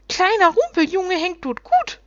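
high, loud gnome voice, slowing; shrieking little creatures. A narrator's reading. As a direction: crow it as delighted little creatures. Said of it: "schrien die Rumpelwichte".

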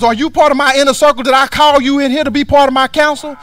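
loud, rising preacher's rebuke; preaching at full force. Acted. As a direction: hammer it home from the pulpit. Preacher voicing prophet's rebuke.

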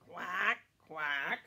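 put-on low quacks for children to copy; duck quack. Shown as a demonstration. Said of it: "Can you say that?".

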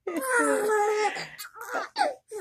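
high burst of laughter; laughing. Real, not acted. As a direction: crack up laughing. Grandma laughing at baby.

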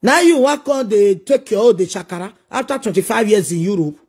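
ordinary talk

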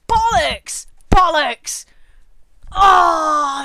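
loud, drawn-out swearing; loud groaning outburst; exasperated cursing. Real, not acted. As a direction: shout it slowly in frustration. Cursing the failed run.

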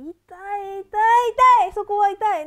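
loud high pained yelps; crying out in pain. Real, not acted. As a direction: shout from sharp pain. Massage pressure hitting sore spot.

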